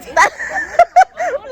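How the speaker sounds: high burst of laughter; loud laugh. Real, not acted. (ഉറക്കെ ചിരി)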